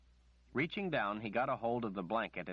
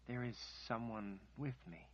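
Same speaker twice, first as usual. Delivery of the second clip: hushed, distant; 3 semitones higher, 7 dB quieter than usual. Acted. Kaelin's haunted whisper from underworld.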